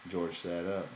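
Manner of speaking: ordinary delivery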